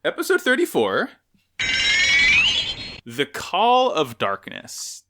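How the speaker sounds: slow dramatic title read; dramatic announcer. Pretend. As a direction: announce it like a movie trailer.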